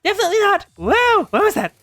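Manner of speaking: high, loud yelp; alarmed outcry. Acted. Asuna answering as the blast hits, alarmed yelp. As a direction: yelp it out, pitch way up.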